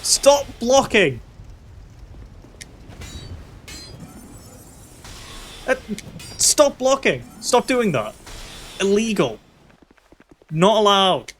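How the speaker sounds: high, whiny, mock-scolding pleas. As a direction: scold the enemy like a fussy referee. Scolding the blocking enemy.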